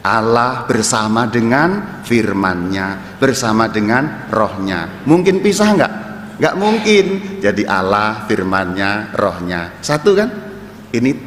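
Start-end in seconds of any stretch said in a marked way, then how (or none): 0.0-5.1 slow and deliberate, word by word; pelan-pelan. Shown as a demonstration. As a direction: spell it out slowly so all follow. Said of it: "Saya ulangi pelan-pelan supaya makin paham". (diucapkan pelan-pelan, kata demi kata)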